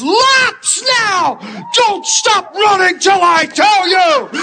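high, drawn-out mock coach yell; coach's scream. Coach punishing the panicked boy, screamed order. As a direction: holler it across the field like an angry coach. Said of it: "he screams the little boy's name".

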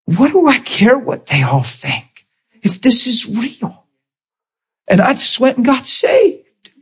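high, slow, overcome delivery; emotional confession. Retold or imitated. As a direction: act out a heart bursting, drawn out. Acts out coworker's emotional conversion story.

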